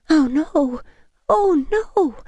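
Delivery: high, rising distressed cry; whimpering cry of alarm. A narrator's reading. Woman sees vampire's gash, distressed cry.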